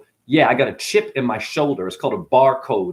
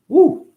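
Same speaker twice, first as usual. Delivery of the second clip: whoop; 5 dB louder than usual. Acted. Sudden whoop mid rant.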